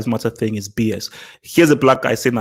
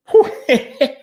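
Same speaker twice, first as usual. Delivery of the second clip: high burst of laughter; 13 semitones higher, 5 dB louder than usual. Real, not acted. Laughing at George's comment.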